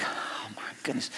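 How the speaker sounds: breathless unvoiced whisper of dismay. Listener whispering in disbelief at the lovestruck friend. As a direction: mutter it under your breath, overwhelmed. Exasperated reaction to lovestruck talk.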